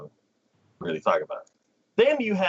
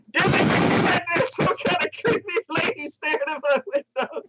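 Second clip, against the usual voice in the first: put-on high, put-upon girl voice; annoyed little girl. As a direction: grumble like a bratty kid.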